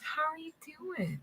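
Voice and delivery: a spirit faking polite concern; sweet-talking spirit. Retold or imitated. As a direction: coax them gently, all false sweetness. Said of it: "speaks to you like this".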